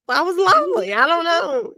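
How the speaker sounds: high, mock-pitiful whine; plaintive. Sick friend explaining her calls, playfully plaintive and high.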